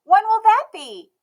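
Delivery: put-on high, bright little girl voice, loud and proud; a stubborn little girl declaring herself; Emmy's child voice. A narrator's reading. Emmy asking.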